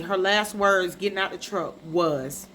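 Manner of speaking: ordinary talk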